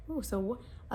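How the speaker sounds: plain reading voice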